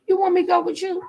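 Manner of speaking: high, wary voice for the other woman; mimicking mistress. Vlogger voicing the mistress asking if she should follow, high. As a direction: squeak it up high, unsure. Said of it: "She said".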